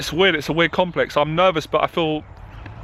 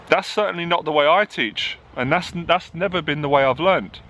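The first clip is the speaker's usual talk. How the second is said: ordinary talk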